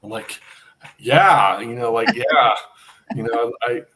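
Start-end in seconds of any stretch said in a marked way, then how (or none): 1.0-1.6 louder, eager yes. Retold or imitated. Accepting Roger's invitation with a loud, eager yes. 2.2-2.5 louder, eager yes. Retold or imitated. Accepting Roger's invitation with a loud, eager yes.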